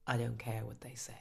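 defiant hushed whisper. Acted. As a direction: say it under your breath.

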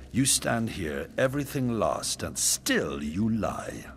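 loud, high, then a slow final jab; angry accusation. Acted. King accusing the defiant queen of lying, angrily. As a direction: accuse her, slowing at the end.

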